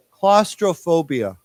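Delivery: "Claustrophobia" slow, every part distinct; word modelling. Shown as a demonstration. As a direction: say "Claustrophobia" slowly for learners to copy. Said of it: "here's a very long word".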